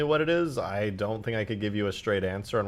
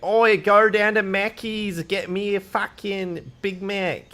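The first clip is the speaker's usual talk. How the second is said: loud, high, drawn-out Aussie yell; exaggerated Australian accent, hollered out slowly; broad mock-Aussie shout; 10 semitones higher, 7 dB louder, slower than usual. Shown as a demonstration. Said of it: "The Aussie accent fucks up my English accent".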